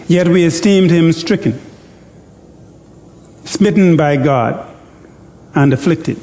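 slow, measured Bible reading with long pauses; deliberate pulpit reading of scripture; solemn scripture reading. Acted. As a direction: read Isaiah slowly, letting each phrase sink in.

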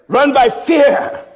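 drawn out, loud and high; slow emphatic preaching. Acted. Preacher stressing fear to the church, slow and loud. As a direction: stretch each word for weight.

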